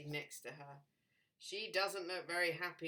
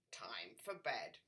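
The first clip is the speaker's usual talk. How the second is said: whispering frog; 9 semitones lower, 3 dB quieter than usual. Acted. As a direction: let the frog whisper his wishes.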